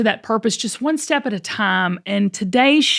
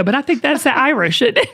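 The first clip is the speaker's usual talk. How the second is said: normal talking voice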